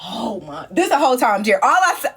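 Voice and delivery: ordinary delivery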